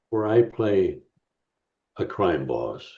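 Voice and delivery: a menacing gangster rumble on crime boss. Acted. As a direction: drop low and quiet for the crime boss. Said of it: "I love your crime boss voice".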